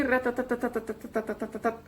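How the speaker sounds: "ratatatatatatatatatatat" as a level machine-gun run; imitating rapid monotone Spanish in "ratatatatatatatatatatat"; rapid even rattle. Shown as a demonstration. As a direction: rattle off "ratatatatatatatatatatat" on one note. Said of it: "así, es muy así".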